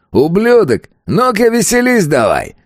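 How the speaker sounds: high-pitched taunting yell; yelling bandit. A narrator's reading. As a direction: heckle him across the stadium. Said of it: "заорал на него тот бандит". (задиристый высокий крик)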